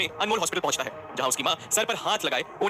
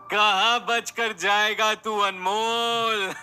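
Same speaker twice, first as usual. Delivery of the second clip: higher, louder, slow words through mad laughter; laughing madly; 5 semitones higher, 5 dB louder, much slower than usual. Acted. Villain laughing as he threatens Anmol. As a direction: taunt him while cackling. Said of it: "पागलों की तरह हंसते हुए कहता है".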